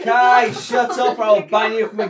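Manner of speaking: loud and slow through laughter; laughing scold. Real, not acted. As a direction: scold while cracking up.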